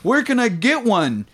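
high, slow eager holler; mock calling out. Heckler asking a stranger about his jacket, high holler. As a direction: call it slowly across the street. Acted heckle, shouted out window.

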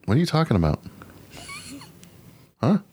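ordinary talk, nothing marked

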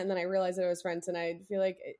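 ordinary talk

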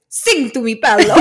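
a playful movie quote with an Italian lilt; Italian accent. Retold or imitated. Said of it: "I can do an Italian accent".

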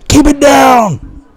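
high, slow mock shout of a dad; dad yelling. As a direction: bark it like a fed-up dad in a hotel room. Dad yelling at kid in hotel.

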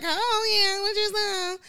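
squeaky flirty aside; mock sweet high voice; high cute quote. Acting out a cute voice.

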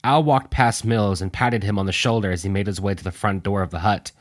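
usual speaking voice

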